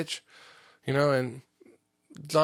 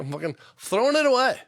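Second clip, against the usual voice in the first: high, raised-voice mock scolding; exasperated threat; 9 semitones higher, 4 dB louder than usual. Parent to child over a lost tape measure, heated threat. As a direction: act out a parent at the end of their rope.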